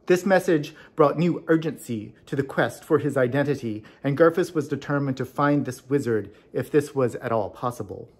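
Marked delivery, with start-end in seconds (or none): none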